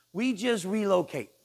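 ordinary talk

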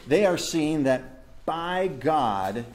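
normal talking voice

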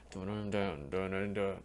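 low sung dun dun dun mimicking the bass; humming the bass line. Reviewer humming the song's bass line, low and rhythmic. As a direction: hum the bassline rhythm as a riff. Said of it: "the bass line".

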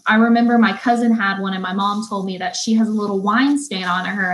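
ordinary talk, nothing marked

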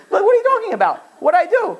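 very high-pitched mock disbelief; squeaky, hammed-up innocence; bewildered protest. Husband home in a great mood, blindsided, high bewildered protest.

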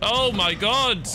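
high-pitched panicked disbelief; a frightened player, voice jumping up in panic; real panic. Real, not acted. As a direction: squeal it out as the stalker closes in. Mister X appears, panic.

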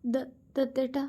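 timid, high-pitched faltering voice; trembling call. A narrator's reading. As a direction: stumble on the name, trembling. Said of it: "അവൾ വിറച്ചുകൊണ്ട് അവനെ വിളിച്ചു".

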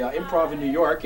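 normal talking voice

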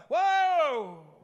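high, loud, drawn-out whoa; urgent stop call, falling away; warning shout. Shown as a demonstration. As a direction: holler to stop a backing truck. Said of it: "if you're backing up a truck".

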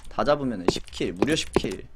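ordinary delivery

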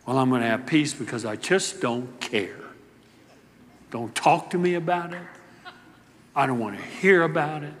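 low, soft, dismissive mutter; apathetic person. Retold or imitated. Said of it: "as practiced by some in our world today".

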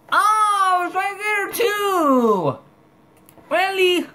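very high, loud and drawn out; shocked squeal. Real, not acted. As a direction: squeal it in disbelief. Obstacle right in the way.

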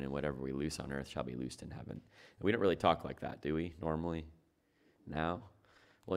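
normal talking voice

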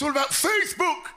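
high, exclaimed put-on voice; mocking young people. Comic mocking young people's websites, high squeal. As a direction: squeal the name in mock excitement.

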